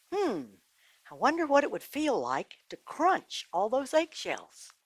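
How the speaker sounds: put-on high, slow, curious little boy voice; a small boy plotting mischief; little boy Tommy. A narrator's reading. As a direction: wonder aloud like a mischievous child.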